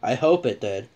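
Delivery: ordinary talk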